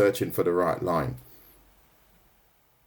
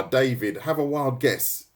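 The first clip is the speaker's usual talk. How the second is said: normal talking voice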